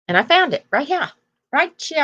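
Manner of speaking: put-on high, bouncy sassy-baby singsong on "right here". Talking to viewers, mimicking the sassy baby on the card, high and sassy. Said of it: "Have you ever heard anybody talk like that?".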